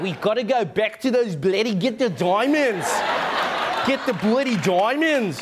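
badly faked South African accent, vowels stretched; slow, hammed-up South African twang; horrible South African accent. Mocking DiCaprio's role in Blood Diamond, bad South African accent. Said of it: "horrible South African accent".

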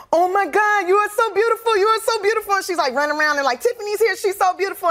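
high excited gushing; imitating an overjoyed girl. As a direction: gush like a thrilled fan. Group home girl greeting, retold.

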